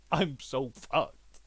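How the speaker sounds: dragged out slowly in defeat; mock resigned slow moan; slow doomed groan. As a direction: let it sink in, very slowly. Villain realising he's doomed.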